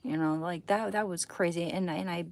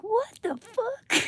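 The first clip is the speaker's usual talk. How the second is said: shocked outburst; 7 dB louder, much slower than usual. Retold or imitated. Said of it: "someone's gonna still be like".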